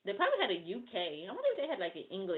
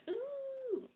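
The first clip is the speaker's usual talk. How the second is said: mock sweet greeting; 8 semitones higher, slower than usual. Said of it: "I'm like".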